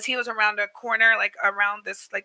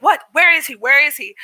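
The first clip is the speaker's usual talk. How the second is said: higher, louder retold demand; indignant alarm; 4 semitones higher, 5 dB louder than usual. Shop lady demands the rude man's whereabouts, urgently alarmed. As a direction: imitate an urgent, protective woman.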